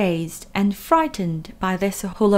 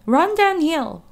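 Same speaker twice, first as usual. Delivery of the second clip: higher, louder cow voice suggesting; 7 semitones higher, 4 dB louder than usual. A narrator's reading. Cows suggesting to Wilbur, higher. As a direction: offer it like a helpful cow. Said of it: "suggested the cows".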